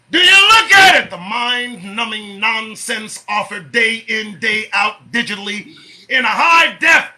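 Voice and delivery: loud, high, stretched-out tirade; ranting shout. Real, not acted. Host berating listeners over mind-numbing nonsense, shouting. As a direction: hammer it out at full voice.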